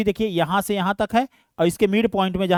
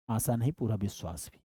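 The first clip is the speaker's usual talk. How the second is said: voicing a student; 9 semitones lower, 13 dB quieter than usual. Teacher voices student's reply.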